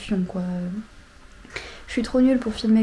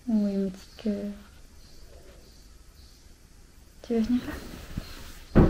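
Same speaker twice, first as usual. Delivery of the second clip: tender; 7 dB quieter, slower than usual. Real, not acted. Talking tenderly to a pet.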